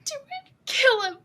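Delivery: high, crying vengeful plea; tearful rage. Acted. Urging Elrond to kill the orc, tearful and furious. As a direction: squeeze it out high through tears. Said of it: "You're crying right now".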